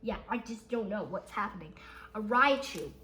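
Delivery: normal talking voice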